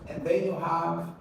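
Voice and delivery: normal talking voice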